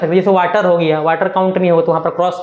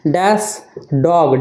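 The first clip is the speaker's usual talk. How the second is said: slow dictation; 3 semitones lower, much slower than usual. Shown as a demonstration. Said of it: "teacher dictating example".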